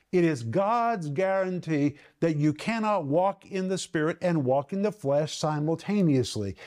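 ordinary talk

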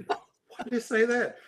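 incredulous self-mock. Mock regret at own blunt answer.

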